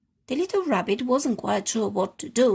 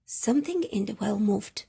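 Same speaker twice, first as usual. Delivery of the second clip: ordinary delivery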